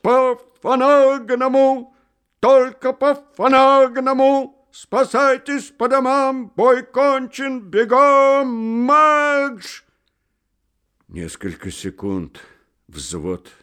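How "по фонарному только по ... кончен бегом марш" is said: high, loud, slow burred bellow; trumpet-like howl. Acted. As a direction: blare it like a cavalry trumpet. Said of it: "провыл, как кавалерийская труба".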